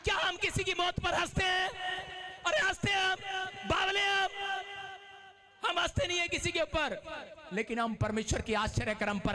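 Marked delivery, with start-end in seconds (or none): 0.0-7.0 preaching. Acted. Preacher rousing the congregation to praise. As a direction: whip up the congregation.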